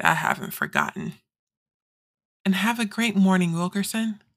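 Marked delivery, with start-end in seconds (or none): 0.0-1.1 much lower ominous threat; menacing voice. Acted. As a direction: drop into a sinister voice. Threat to Cordell, joking menace.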